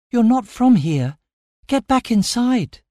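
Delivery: put-on high-pitched girl's warning; urgent warning. A narrator's reading. Bound Amira orders the stranger inside, urgent. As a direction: send him away urgently.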